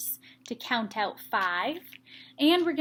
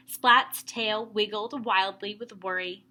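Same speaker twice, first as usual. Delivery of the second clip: ordinary delivery, nothing marked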